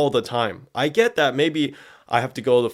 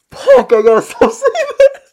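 much higher and louder, cracking up; laughing exasperation; 12 dB louder than usual. Real, not acted. Caught saying status again, laughing exasperation. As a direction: squeal it mid-laugh.

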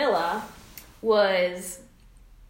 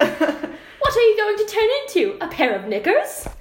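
mock posh British accent, much higher; 12 semitones higher, 7 dB louder than usual. Shown as a demonstration. Reading the royal transcript aloud for a friend, mock posh British. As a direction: read Camilla's line in a hammed-up British accent. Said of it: "should I read it in an accent".

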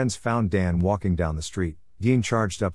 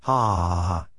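spoken mock villain laughter; read-out villain laugh; 3 semitones lower than usual. A narrator's reading. Villain gloats in the story.

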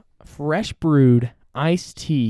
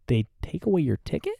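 usual speaking voice

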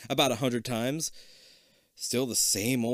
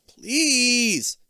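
very high, loud begging; hammed-up, drawn-out begging; pleading; 12 semitones higher, 8 dB louder than usual. A narrator's reading. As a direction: stretch it into a big plea. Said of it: "you plead".

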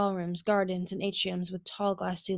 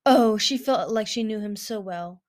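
ordinary delivery

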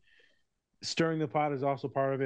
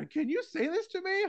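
imitating client. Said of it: "my clients would be like".

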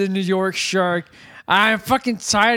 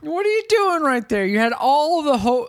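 much higher put-on voice; mock shark voice; 5 semitones higher than usual. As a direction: scold like an exasperated shark. Shark scolding itself.

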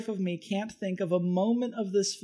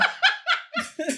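high laugh. Real, not acted. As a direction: burst of high-pitched laughter. Co-host's joke makes them laugh.

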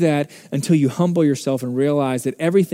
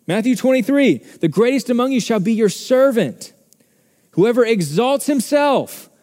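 raised, higher-pitched preaching; 7 semitones higher, 3 dB louder than usual. Acted. Pastor urging the congregation toward servanthood, preaching hard. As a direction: drive the point home from the pulpit. Preacher rising to full force.

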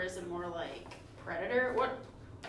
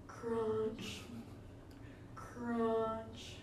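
soft whispered ASMR parody; mock ASMR; 6 dB quieter, slower than usual. Host mocking an ASMR video for her cohost, hushed whisper. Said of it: "in a relaxation A S M R video".

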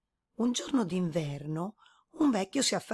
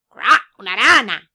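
much louder croak with the name; croaking frog; 3 semitones higher, 14 dB louder than usual. A narrator's reading. Frog answering the boar, loud and croaky.

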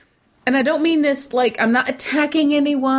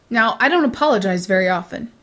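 usual speaking voice

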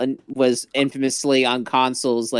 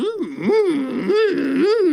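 rising mm of delight; savoring hum; 14 semitones higher, much slower than usual. Pretend. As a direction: hum like tasting something great. Savoring food, rising high.

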